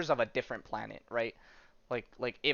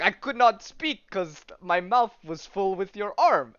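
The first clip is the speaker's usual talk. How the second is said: put-on slow, higher Russian-accented dog; mock Russian accent, measured and a bit louder; 5 semitones higher, 6 dB louder, slower than usual. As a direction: explain it slowly in a light Russian accent. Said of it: "it's in a Russian accent".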